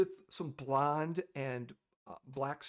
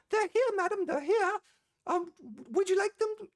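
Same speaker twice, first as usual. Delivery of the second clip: high, louder receptionist calling through the door; eager announcement; 14 semitones higher, 5 dB louder than usual. Acted. Receptionist telling her madam the guests are here, high and loud.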